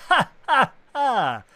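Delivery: high villain cackle, loud; evil laugh. Acted. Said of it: "his evil laugh echoed".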